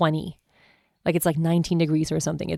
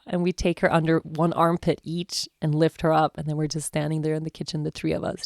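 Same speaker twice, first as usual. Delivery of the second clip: usual speaking voice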